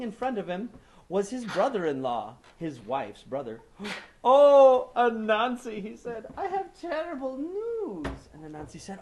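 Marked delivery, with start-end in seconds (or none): 4.2-5.8 loud wailing cry. Acted. Grieving brother-in-law arrives. 6.4-8.3 slow crying voice. Acted. Brother-in-law bringing sad news.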